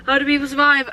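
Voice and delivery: high, whimpering exclamation; mock despair. Real, not acted. Shopper lamenting food prices, in mock-tearful despair. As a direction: wail it lightly after a gasp.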